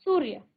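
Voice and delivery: सूर्य said crisply with half र; teacher repeats सूर्य, half र; model answer. Shown as a demonstration. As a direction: repeat सूर्य as the correct form for students. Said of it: "क्या कहेंगे?".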